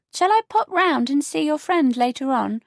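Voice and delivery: put-on a prim elderly neighbour; prim older neighbour. Beatrice voiced.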